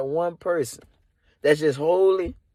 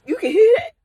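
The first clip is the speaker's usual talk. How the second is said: startled high question; 13 semitones higher, 4 dB louder, faster than usual. Retold or imitated. To Jesus who heard his thought, startled high yelp. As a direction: voice jumps up in alarm.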